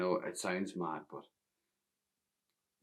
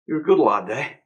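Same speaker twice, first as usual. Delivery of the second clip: hearty boss; 5 semitones higher, 10 dB louder than usual. Acted. Boss praising boy.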